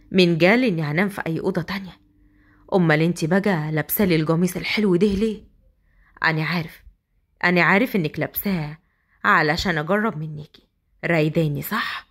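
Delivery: low, knowing man's voice; teasing husband. Acted. Asad teasing his bride about her nightgown, low and smug.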